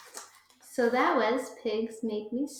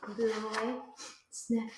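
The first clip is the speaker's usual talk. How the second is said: ordinary delivery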